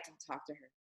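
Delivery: low, hushed warning behind someone's back; hushed gossip. Acted. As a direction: pass it on under your breath like a secret. Voicing gossip warning about a seller.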